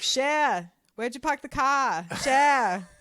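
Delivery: 喊着模仿纽约口音的亲戚; New York accent impression. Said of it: "that's, like, a good impression".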